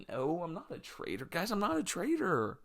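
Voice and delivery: soft, high mock whimper; faint, hammed-up panic before passing out; panicked denial. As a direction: plead weakly, pitch climbing. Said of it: "panic of being a traitor".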